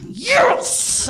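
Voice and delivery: high-pitched celebratory yes; retold victory cry; mock triumph. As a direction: burst out in a high, exultant squeal. Retold triumph after being greeted.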